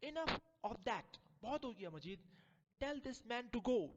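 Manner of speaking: high, loud, slow command; angry order. Acted. Mistress ordering the servant to send him away. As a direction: end it with force.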